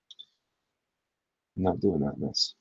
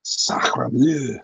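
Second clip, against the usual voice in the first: mock French exclamation; 3 semitones higher, 6 dB louder than usual. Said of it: "Why am I doing that sound?".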